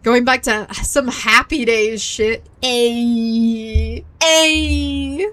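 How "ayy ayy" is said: long held cool-guy catchphrase; Fonzie. Acted. Streamer going back to Happy Days, Fonzie impression. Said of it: "Talk like Fonzie".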